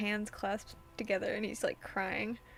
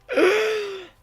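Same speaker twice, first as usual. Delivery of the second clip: sharp loud gasp, pitched up; shocked gasp; 8 semitones higher, 11 dB louder than usual. Real, not acted. Shocked reaction to game scene.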